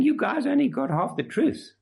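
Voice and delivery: a hint of a know-it-all teacher undermining Epaphras; mock false teachers. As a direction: sneer it like the smooth troublemakers. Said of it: "They're saying".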